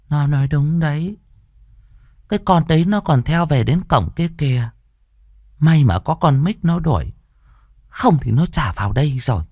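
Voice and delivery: put-on high, steady old woman voice; the grandmother calmly confirming the ghost.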